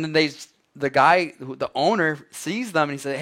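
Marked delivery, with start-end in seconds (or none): none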